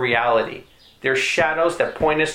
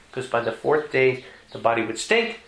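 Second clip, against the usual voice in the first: ordinary talk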